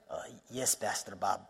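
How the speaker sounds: soft, low Indian accent imitation; affectionate Indian accent impression of a friend. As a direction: mimic Mel's quiet Indian English reply. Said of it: "I'll do a little Mel imitation".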